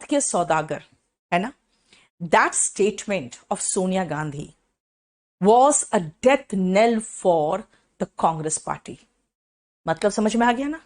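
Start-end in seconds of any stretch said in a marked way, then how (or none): 2.2-9.0 very slow dictated example sentence; slow dictation. Shown as a demonstration. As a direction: read it out slowly for notes.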